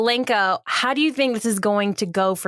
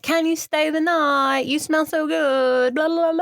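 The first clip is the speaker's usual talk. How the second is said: put-on slow, higher, sweet child voice; cute kids; 5 semitones higher, slower than usual. Kids beg her to stay the night, cutesy. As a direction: stretch it out like a pleading small child. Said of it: "And the kids are so cute".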